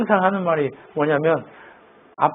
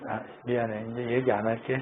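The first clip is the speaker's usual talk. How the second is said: low, much softer aside; quiet apology; 8 semitones lower, 13 dB quieter than usual. Real, not acted. Preacher apologising to child mid-sermon, hushed.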